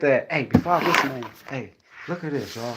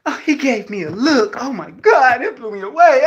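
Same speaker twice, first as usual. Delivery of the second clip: higher, louder, gushing mock; mock swoon, higher and louder; swooning woman; 9 semitones higher, 5 dB louder than usual. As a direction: ham up a smitten woman. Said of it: "You know how, like, women".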